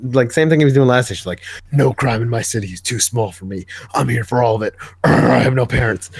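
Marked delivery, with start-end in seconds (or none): 1.7-6.1 mock brooding vigilante voice; Batman. Parodying the hero's speeches to co-host, mock brooding. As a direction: put on a self-serious dark hero voice. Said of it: "Batman's like".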